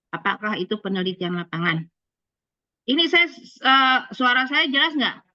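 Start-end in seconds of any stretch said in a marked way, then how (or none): 2.9-5.2 to someone hard of hearing. Real, not acted. Checking whether her voice is clear.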